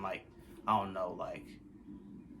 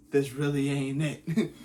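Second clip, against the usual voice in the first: half-laughing despair, a little louder; laughing through it; 4 semitones higher, 5 dB louder, slower than usual. Retold or imitated. Telling his friends about the foot, laughing. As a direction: laugh it off while saying it's bad.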